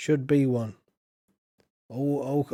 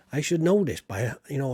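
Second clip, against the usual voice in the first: normal talking voice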